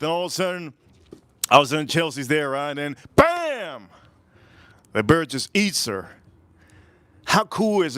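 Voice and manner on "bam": high, stretched-out mock bam; dramatic impact. Storyteller as the bear hits Chelsea, dramatic exclamation. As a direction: punch it high, letting the pitch fall. Imitating the bear's sudden attack.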